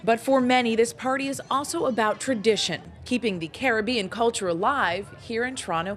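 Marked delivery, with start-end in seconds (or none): none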